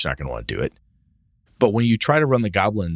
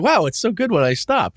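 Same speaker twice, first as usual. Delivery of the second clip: mock relief; 10 semitones higher than usual.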